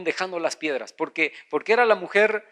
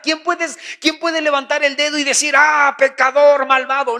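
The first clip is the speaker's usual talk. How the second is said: accusing; 7 semitones higher, 6 dB louder than usual. Acted. Preacher acting out a finger-pointer judging a sinner, accusing. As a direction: denounce them loudly, stretching the words.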